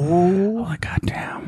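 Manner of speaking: whispered swoon; breathless whisper. Real, not acted. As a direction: breathe it out in awe. Swooning over an actor.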